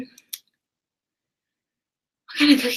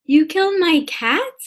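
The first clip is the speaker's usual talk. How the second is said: incredulous question; 8 semitones higher than usual. Acted. Shocked reply to the text.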